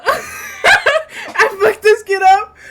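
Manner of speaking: laughing through the words; cracking up while talking. Real, not acted. Laughing at own game choice.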